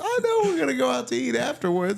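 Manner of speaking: put-on high, loud old-lady voice; hammed-up elderly caller insisting. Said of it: "like, an old lady".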